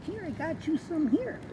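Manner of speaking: high, cooing voice for the pet. Real, not acted. Owner cooing to his cat over food and catnip.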